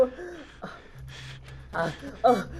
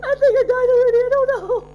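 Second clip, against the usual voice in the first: high, loud tearful panic; panicked; 11 semitones higher, 6 dB louder than usual. Real, not acted. Player thinks the game killed them, panicked. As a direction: cry it out in fright.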